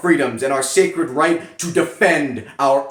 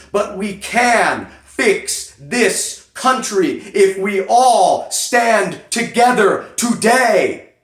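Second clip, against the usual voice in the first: slow, higher and louder rallying call; rousing climax; 6 semitones higher, 3 dB louder, slower than usual. Acted. Rallying NRA members to stand together, slow and rising. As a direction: build it into a stirring call to unite.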